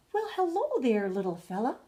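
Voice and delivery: high, cheery hello dropping at the end; friendly goldfish calling out; cheerful call. Acted. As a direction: greet a tiny friend brightly. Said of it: "Gilbert called out cheerfully".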